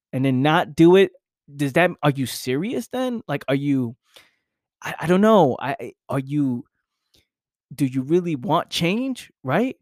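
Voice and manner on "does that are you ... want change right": ordinary delivery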